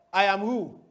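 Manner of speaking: normal talking voice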